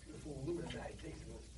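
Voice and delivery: ordinary talk, nothing marked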